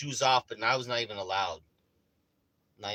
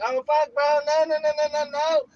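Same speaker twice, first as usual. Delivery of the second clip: much higher, louder frantic no no no; mock panic; 9 dB louder than usual. Homeowner waiting on the rotary dial, loud high panic. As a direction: panic like the burglar is inside.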